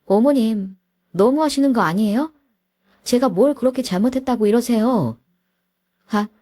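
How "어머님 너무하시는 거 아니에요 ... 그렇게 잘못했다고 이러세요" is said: higher, pleading outrage; indignant protest. Acted. Wife protests to mother-in-law, higher and indignant. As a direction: protest the unfairness.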